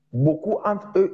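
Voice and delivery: ordinary delivery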